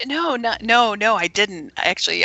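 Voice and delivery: normal talking voice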